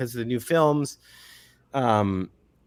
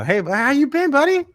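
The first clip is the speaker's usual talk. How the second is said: much higher, louder pushy hello; overfriendly greeting; 5 dB louder than usual. Pretend. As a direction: barge in like an old pal. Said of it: "or, you know".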